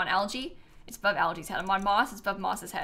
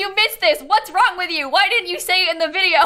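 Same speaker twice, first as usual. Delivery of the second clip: loud, higher, fast teasing mimicry of viewers; mock outraged viewer; 8 semitones higher, 7 dB louder than usual. Streamer mimics outraged viewers to her chat, loud and teasing. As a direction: play the outraged chat, hammed up.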